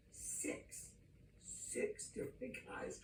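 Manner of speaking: whispered, slow emphatic repeat; hushed whisper. Real, not acted. Preacher repeating the count to his audience, slow whisper.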